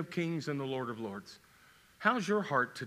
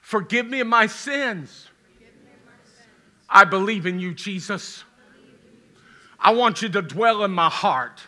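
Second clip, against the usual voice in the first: louder, earnest prayer said for all to repeat; led prayer aloud; 3 semitones higher, 10 dB louder than usual. Acted. Pastor leading the congregation's prayer, in a loud earnest voice. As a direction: pray it out loud line by line.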